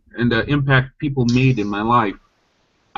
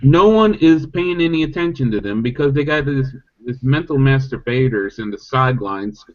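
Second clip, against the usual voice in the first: usual speaking voice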